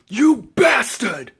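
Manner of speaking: theatrical anime-style indignation; acted outrage. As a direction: draw out the insult in mock fury. Acting Vegeta learning the truth.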